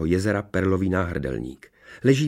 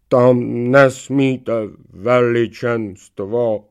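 very slow, loud, higher drawn-out voice; protesting knight's head; 4 semitones higher, 7 dB louder, much slower than usual. A narrator's reading. Tricked knight's head warns the princess, drawn-out protest. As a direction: protest helplessly, stretching every word. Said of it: "marně protestovala hlava oklamaného rytíře".

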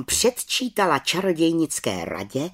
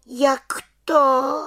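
high hurt asking; little witch taking offence; offended question; 11 semitones higher, 4 dB louder than usual. Acted. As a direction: ask as if insulted. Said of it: "dotčeně se zeptala".

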